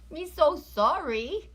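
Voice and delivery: a cutesy, hammed-up sorry; mock apology. Streamer apologising playfully to viewers, baby-talk sorry.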